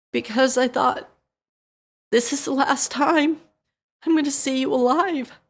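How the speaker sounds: fighting back tears as you remember; voice breaking. Real, not acted. Recalling son near death.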